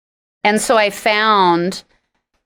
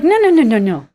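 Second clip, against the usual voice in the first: loud, higher string of no's; emphatic refusal; 4 semitones higher, 4 dB louder than usual. Retold or imitated. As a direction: shut it down hard. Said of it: "And I was like".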